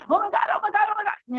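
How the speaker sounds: high-pitched, rapid panicked squeal; roller-coaster panic. Acted. Coach acting out a rider on the drop, high panicked cry. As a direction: panic as the coaster plunges, voice shooting up. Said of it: "you go down and you're like".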